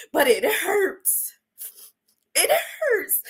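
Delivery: real tears, voice cracking high on the second hurts; choked sob of real pain; crying. Real, not acted. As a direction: let it break as you repeat it. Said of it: "getting so emotional".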